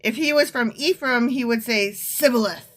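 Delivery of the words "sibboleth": "Sibboleth" begun with S instead of SH; mispronounced with S. Shown as a demonstration. Ephraimite failing the test, "Sibboleth" with S. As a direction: say "Sibboleth" with a plain S at the start. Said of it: "cannot pronounce the word correctly".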